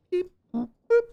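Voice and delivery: playful high robot beeps; beep boop noises. Pretend. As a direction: chirp them out like a little machine. Playful robot noises while working.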